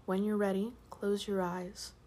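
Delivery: soft hushed bedtime voice; sleep story narrator. Acted. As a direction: ease them gently toward sleep. Sleep story intro, whispered and soft.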